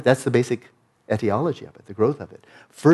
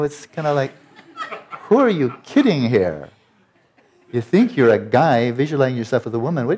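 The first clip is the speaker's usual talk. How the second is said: usual speaking voice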